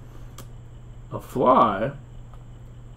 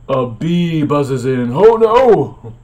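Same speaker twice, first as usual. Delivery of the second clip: mock alarm; 3 semitones higher, 8 dB louder, much slower than usual. Mock panic at bee arrival.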